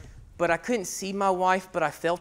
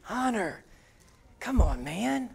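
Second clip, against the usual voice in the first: soft, much quieter inner voice; self-talk; 8 dB quieter than usual. Retold or imitated. Father scolding himself under his breath, soft inner voice. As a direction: mutter to yourself. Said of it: "And I go like".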